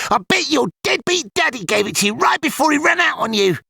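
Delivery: high, cruel schoolboy jeer; angrier taunt. A narrator's reading. Petey insulting Ilian's father, high angry jeer. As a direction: twist the knife with spite. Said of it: "continued in angrier tones".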